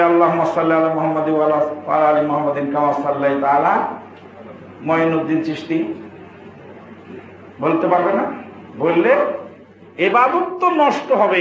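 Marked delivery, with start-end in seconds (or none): 0.0-5.9 quick, lower spoken rattle ending in a question; rapid mock recitation. Preacher asks if followers swap in the saint's name, rattled off questioningly. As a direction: run through it quickly as a rhetorical question. Rhetorical misquote of the durood.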